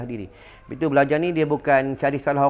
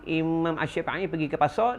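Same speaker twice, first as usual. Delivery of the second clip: ordinary talk, nothing marked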